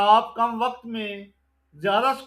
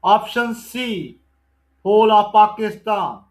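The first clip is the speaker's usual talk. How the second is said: slow and louder, announcing each question; loud, deliberate MCQ recitation; loud slow quiz reading; 8 dB louder, much slower than usual. Acted. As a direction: call out the question and option slowly. Reads MCQ question and answer.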